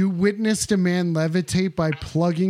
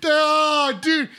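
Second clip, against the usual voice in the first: ordinary talk, nothing marked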